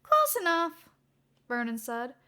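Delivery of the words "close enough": high, louder shrug of a reply; high-voiced assistant. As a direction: brush it off in a high young voice.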